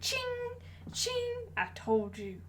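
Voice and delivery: a hint of a southern accent with spur sounds; mock southern gunslinger. Retired Boba Fett walking into the street, in a light southern accent. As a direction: play a retired cowboy, tired and soft. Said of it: "southern accent".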